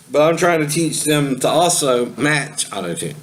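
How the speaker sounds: ordinary delivery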